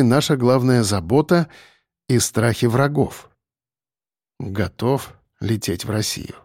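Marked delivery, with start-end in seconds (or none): 4.4-6.4 low, soft and slow; hushed, unhurried murmur; quiet. Acted. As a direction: say it quietly, taking your time. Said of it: "сказал я тихо".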